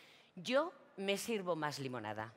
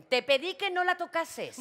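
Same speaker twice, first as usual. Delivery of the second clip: much higher and louder; angry reproach; 11 semitones higher, 7 dB louder than usual. Acted. Ana angrily reproaches María for touching grandma, high and loud. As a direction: snap it at her, sharp and loud.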